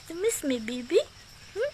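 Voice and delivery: put-on lilting baby talk to a pet; doggy baby talk. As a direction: coo it to the dog like a baby. Cooing to the dog. (malambing na boses sa aso)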